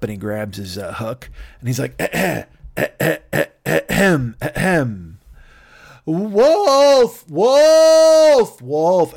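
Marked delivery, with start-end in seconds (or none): 2.0-5.2 exaggerated, repeated throat-clearing; stagey ahem. Shepherd readies himself to yell. 6.1-9.1 loud, high, long-drawn cry of alarm; sustained false-alarm shout, dropping on the last; yelling wolf. Acted. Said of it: "And he yells".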